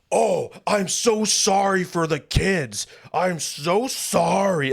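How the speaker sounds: high, slow, sarcastic sing-song apology; mocking fake apology. Retelling the rude fan's apology, slow and mocking. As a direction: drag it out like you don't mean a word.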